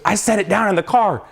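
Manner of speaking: mildly acted alarm; retold panic. Dad panics at forgotten box.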